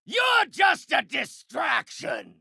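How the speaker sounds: irritated battle shout; enraged villain yell; furious dismissal. Acted. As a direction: yell it to brush off a pest. Villain yells in fight.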